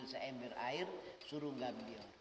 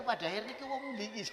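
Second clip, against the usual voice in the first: Rungkut village call; 6 semitones higher than usual. Retold or imitated. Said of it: "itu kalau manggil itu suaranya keras".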